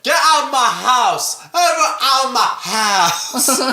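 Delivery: high, hammed-up movie outburst; mock yelling. Co-host imitates Johnny throwing everyone out, mock yelling. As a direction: yell it melodramatically, stretching the repeat.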